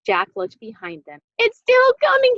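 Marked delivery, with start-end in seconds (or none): 1.4-2.4 high, loud panicked shriek; shrieking in fear. A narrator's reading. Jack looking back at the chasing bone, panicked shriek. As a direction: shriek it over your shoulder. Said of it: "he shrieked".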